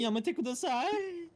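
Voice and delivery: put-on high, slow breathy anime girl.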